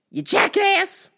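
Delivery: high, drawn-out, slow insult; angry insult. Retold or imitated. Part of him wants to fight driver, angry jeer.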